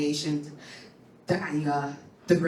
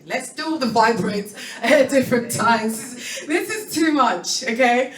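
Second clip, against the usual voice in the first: higher, louder, laughing it off; laughing protest; 7 semitones higher, 3 dB louder than usual. Retold or imitated. Refusing more bad news from the doctor, laughing and high. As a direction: push it away through a laugh. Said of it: "I'm like".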